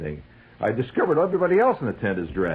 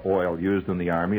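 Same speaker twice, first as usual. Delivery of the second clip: ordinary delivery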